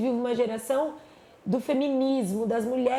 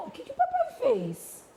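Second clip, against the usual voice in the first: high gentle mother. Retold or imitated. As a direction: ask the little one kindly. Mother voicing herself to son.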